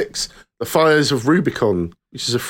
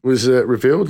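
ordinary talk

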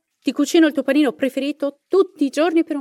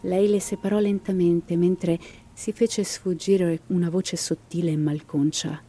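dramatic storyteller reading a ghost legend; story narration; 8 semitones lower than usual. A narrator's reading. Narrator telling listeners the Llorona legend, dramatic storytelling.

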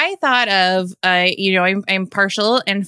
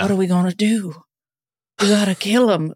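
sultry perfume ad; faster than usual. Retold or imitated. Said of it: "felt like a perfume commercial".

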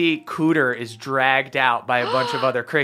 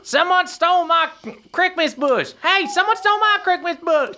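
very high-pitched elf squeal; aggrieved crick elf. Acted. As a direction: wail a complaint as a tiny robbed creature. Robbed crick elf complains to crowd.

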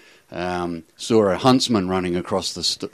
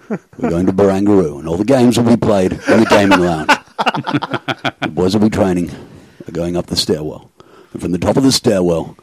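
Russell Crowe. Acted. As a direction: deliver a mock-heroic speech, measured and grave. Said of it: "Russell, oh, he's responded".

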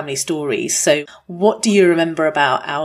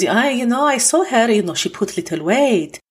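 put-on higher Armenian grandmother accent, gossiping; gossipy Armenian granny, lightly done; Mema's Armenian accent; 7 semitones higher than usual. Grandmother gossiping about a celebrity's weight, higher Armenian accent. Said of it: "I'm gonna do an accent now".

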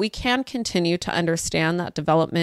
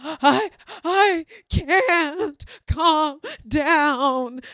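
high, very slow, broken-up mock crying; hammed-up wail between hitching breaths; crying child; 8 semitones higher, much slower than usual. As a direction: imitate a sobbing, gasping child. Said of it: "she looks at me and she's like".

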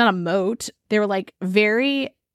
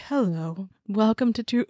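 softer hushed intro; soft ASMR voice; 5 dB quieter than usual. Pretend. Pretending to open a fake ASMR episode of the show, in a softer hushed voice. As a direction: ease into a hushed ASMR greeting. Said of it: "it's our A S M R series".

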